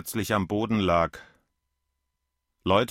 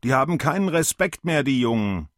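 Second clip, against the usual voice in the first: higher and louder, complaining to the crowd; 7 semitones higher, 3 dB louder than usual. A narrator's reading. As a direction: raise your voice at everyone around. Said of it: "Er sprach laut in die Menge". (laut in die Menge schimpfend)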